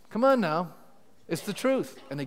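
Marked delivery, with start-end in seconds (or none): none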